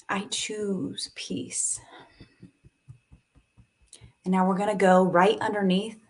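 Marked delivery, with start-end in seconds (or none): 0.1-1.8 a very slow, soft whisper; a drawn-out whispered affirmation. Real, not acted. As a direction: breathe the vow out in a whisper.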